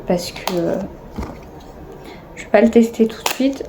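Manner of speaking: usual speaking voice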